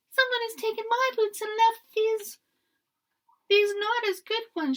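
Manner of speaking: very high, loud whiny wail; wailing Baby Bear. A narrator's reading. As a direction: wail like an upset little cub. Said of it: "she wailed".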